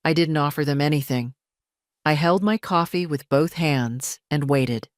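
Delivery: ordinary delivery, nothing marked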